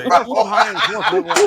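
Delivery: laughing through the punchline. Real, not acted. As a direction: crack up while saying it. Joking about the fat referee.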